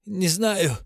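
хриплый сдавленный ответ; прохрипеть через силу. A narrator's reading. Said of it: "Убийца прохрипел". (хрипло)

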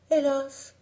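« Hélas » plus aigu et plus fort; hélas plaintif. A narrator's reading. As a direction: soupir de désespoir lancé. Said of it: "dit Alice". (« Hélas » aigu et plaintif)

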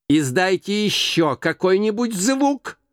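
high-pitched, restrained shout; threatening cry from a cross old boss; окрик. A narrator's reading. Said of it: "закричал Скрудж". (сердитый окрик повыше)

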